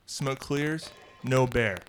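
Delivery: ordinary talk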